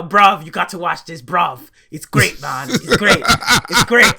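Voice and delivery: loud, hammed-up British bro; badly faked British lad plugging a show; attempted British accent. Podcaster mimicking British shonen bro recommending anime, mock British. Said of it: "Just throw a bruv at the end".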